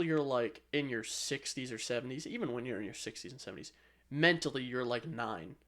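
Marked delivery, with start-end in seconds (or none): none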